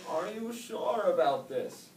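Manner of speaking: high, slow, loud wise snail; old snail questioning young Oscar. A narrator's reading. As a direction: ask slowly like an old sage.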